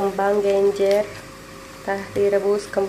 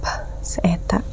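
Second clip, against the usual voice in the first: whisper; 6 dB quieter than usual. Acted. Whispered aside after whisper.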